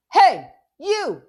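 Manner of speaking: loud, high shout across the street; yelling. Acted. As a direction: shout to stop the thieves. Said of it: "I yell".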